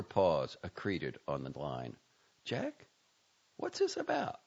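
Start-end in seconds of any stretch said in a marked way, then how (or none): 2.4-4.4 high, cautious female voice; a puzzled partner; wary wife on phone. A narrator's reading. Sophie asks on phone.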